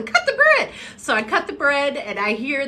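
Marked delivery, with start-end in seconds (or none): 0.0-0.7 high, loud mama voice through a laugh; mom impression with laughter. Woman repeating mom's order, laughing and high. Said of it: "what my mom would say".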